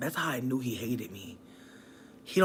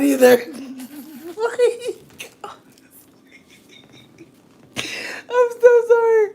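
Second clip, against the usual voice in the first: overwhelmed squeal; 10 semitones higher, 11 dB louder than usual. Real, not acted. Overwhelmed by ex's message.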